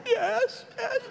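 high, small, whimpering yes; mock child crying. Daughter giving in to dad before the ride, mock sobbing. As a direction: imitate a little girl crying, very high and soft. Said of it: "So she's crying so loud".

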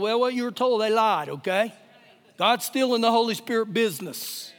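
ordinary talk

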